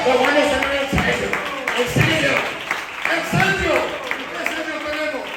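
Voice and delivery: loud, rising preaching at full force; fervent preaching. Acted. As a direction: build it up, preaching loud and high.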